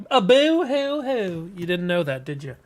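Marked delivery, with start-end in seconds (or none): 0.1-1.5 slow, higher mock Southern crying; Southern boo-hoo. Shown as a demonstration. Showing how Southern people cry, mock Southern boo-hoo. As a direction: draw out a fake Southern wail. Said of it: "Southern people even cry in Southern accents".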